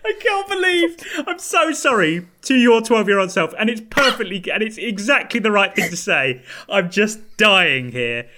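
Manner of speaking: high, loud words broken by laughter; fighting through giggles to get the words out; real laughing fit, pitch way up; laughing helplessly. Real, not acted. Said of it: "I'm crying".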